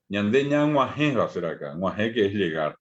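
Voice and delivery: usual speaking voice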